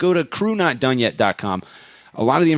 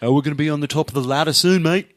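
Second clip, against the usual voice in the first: badly faked New Zealand accent, pitched up; Duncan Naughton; 4 semitones higher than usual. Host mimicking Duncan Naughton on topping the ladder, bad New Zealand accent. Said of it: "possibly the worst New Zealand accent I've ever heard".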